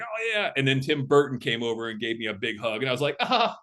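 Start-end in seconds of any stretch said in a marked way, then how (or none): 0.0-0.5 tag. Retold or imitated. Retold hug from colleague. 3.2-3.6 high, breath-catching "ah"; short high fanboy gasp; starstruck gasp. Retold or imitated. Retold starstruck reaction to hug.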